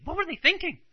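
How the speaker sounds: high-pitched, exasperated question; retold frustration. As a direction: act out her baffled irritation. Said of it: "she started getting frustrated".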